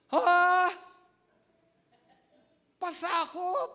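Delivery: loud, high, shocked "Pasakop?"; shocked disbelief. Acted. Pastor wife hearing she must submit, shocked outcry. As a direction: blurt it out in disbelief. Wife's shocked reaction to God.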